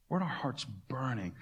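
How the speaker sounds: hushed, wondering repeat of "Weren't our hearts burning?"; soft reflective echo. Shown as a demonstration. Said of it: "Weren't our hearts burning within us".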